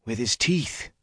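trailing off softly; faint. Acted. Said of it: "he finished faintly".

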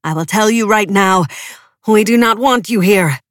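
loud, stern sermon; stern declaration. A narrator's reading. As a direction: cast them out. Alexander rejects cowards.

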